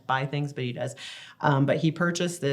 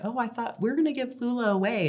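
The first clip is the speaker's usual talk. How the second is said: much higher mock-innocent tease; teasing; 9 semitones higher, faster than usual. Mom teasing her son about giving baby sister away. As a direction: play dumb to tease him. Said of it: "And I'm like".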